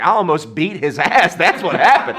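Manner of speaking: high, loud, worked-up boast; mock tough bragging. Man boasts to girlfriend after avoiding a fight, fired up. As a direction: crow it like a pretend tough guy.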